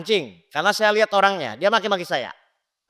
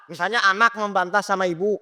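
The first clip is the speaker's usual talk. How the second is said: ordinary delivery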